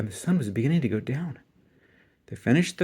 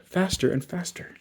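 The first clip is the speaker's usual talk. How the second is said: ordinary delivery, nothing marked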